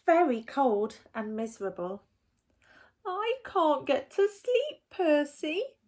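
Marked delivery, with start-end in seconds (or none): 3.0-5.8 high, small squirrel voice; squeaky squirrel voice. A narrator's reading. Squirrel pleading with Percy at his door, high and small. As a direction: pitch it up like a tiny cold squirrel. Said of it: "said the squirrel".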